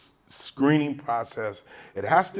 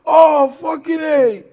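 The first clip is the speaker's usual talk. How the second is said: high, very loud fan shrieking; starstruck fan cop; 13 semitones higher, 13 dB louder, slower than usual. Retold or imitated. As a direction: cop thrilled to meet a TV actor, shrieking high. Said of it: "He's like".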